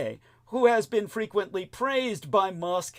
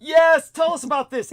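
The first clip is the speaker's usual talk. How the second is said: mock excited; 11 semitones higher, 8 dB louder than usual. Mock excited prompt to co-host.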